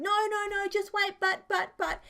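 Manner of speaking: high, flustered stalling. Real, not acted. Flustered interruption mid-recording.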